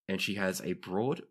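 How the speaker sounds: ordinary talk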